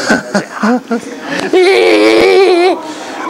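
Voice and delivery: wild high laugh. Real, not acted. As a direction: burst out laughing. Laughing at footage.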